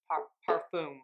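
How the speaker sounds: halting attempt at a French accent on "parfum"; próba francuskiego akcentu. Shown as a demonstration. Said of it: "perfect French accent".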